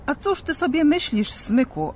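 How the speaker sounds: put-on high, indignant retort to the boy; obruszony odźwierny. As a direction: scoff at the cheeky lad. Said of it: "obruszył się Mikołaj". (oburzony, wyższy głos odźwiernego)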